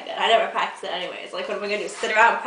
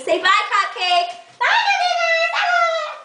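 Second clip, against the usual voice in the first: much higher, louder cooing bye; 6 dB louder, slower than usual. Real, not acted. Owner making her dog wave bye to viewers, high coo. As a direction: coo goodbye on the dog's behalf. Waving the dog goodbye to camera.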